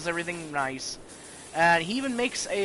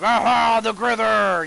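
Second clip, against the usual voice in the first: loud, higher-pitched theatrical roar; uncle's mock monster scare; 5 semitones higher, 9 dB louder than usual. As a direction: jump out and bellow to scare the kids. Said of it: "then the uncle shows up, he's like".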